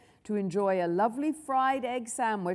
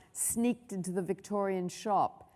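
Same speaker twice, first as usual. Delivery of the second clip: usual speaking voice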